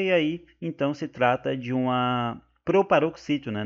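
usual speaking voice

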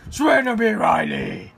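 Batman shout. Acted. Dad quoting Batman at baby Riley, hammed-up Batman shout. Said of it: "he's talking like Batman".

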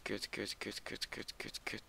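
very quiet, rapid nervous cursing; nervous muttering. Real, not acted. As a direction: mutter it over and over under the breath. Nervous cursing while hiding from mob. (zacht, nerveus gemompel)

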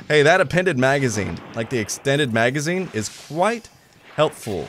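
normal talking voice

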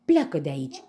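loud, brave command; shout. A narrator's reading. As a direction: shout to chase him off. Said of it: "strigă el". (strigăt curajos)

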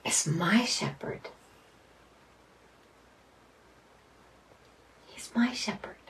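hushed whisper on my shepherd; soft reverent whisper. Real, not acted. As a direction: breathe the words out, barely voiced. Meditating slowly on each word.

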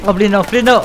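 high, loud frantic begging; panicked please-no plea; desperate pleading. Real, not acted. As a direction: beg the game not to let it happen. Begging during tense moment.

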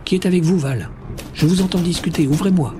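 calling through door. A narrator's reading. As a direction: shout through the closed door. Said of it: "continuait à frapper contre la porte et à appeler".

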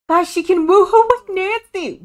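real giddy glee; excited squeal. Real, not acted. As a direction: share the big news, thrilled. Excited announcement.

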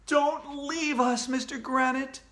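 put-on high, drawn-out child's plea; begging. A narrator's reading. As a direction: plead in a high, stretched-out whine. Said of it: "begged Alexia".

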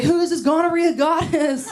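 high, amazed mock awe; guy marvelling at her; awed guy. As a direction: play the smitten guy. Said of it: "He's like".

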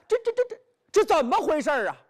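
stammering shock. Acted. Bai Yutang seeing Zhan Zhao free, high flustered stammer. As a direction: trip over the words in sudden alarm.

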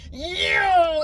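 a sudden high, loud yo as the brother zooms by; mock yell. Telling how her brother passed her on the track, yelling yo. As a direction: shout it out like a kid flying past on a go-kart. Brother racing past, calling out.

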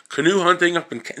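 ordinary talk

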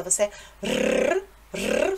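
rrr rolled long on the tongue; rolled R drill. Shown as a demonstration. Teacher drilling a rolled rrr for students. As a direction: trill the rrr slowly like an exercise. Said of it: "A pronúncia do R R é a mesma coisa".

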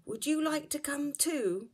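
a hint of an eager small bear; lightly voiced little bear. Baby Bear invites owl.

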